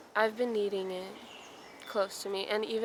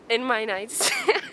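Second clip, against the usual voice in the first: louder, drawn out, breaking into a laugh; 3 semitones higher, 6 dB louder, slower than usual. Real, not acted. Amused at her own slip of the tongue, laughing.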